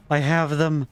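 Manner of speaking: high joyful relief; elated. Real, not acted. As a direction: savor the win. Set finally complete.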